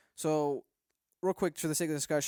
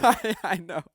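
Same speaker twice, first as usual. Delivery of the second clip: laughing through the words; real laugh breaking into the reply; amused laugh while agreeing; 4 semitones higher, 7 dB louder, slower than usual. Real, not acted. Laughing at the beer comment.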